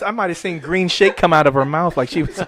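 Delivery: ordinary talk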